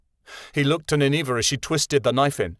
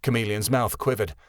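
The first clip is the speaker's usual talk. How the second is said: plain reading voice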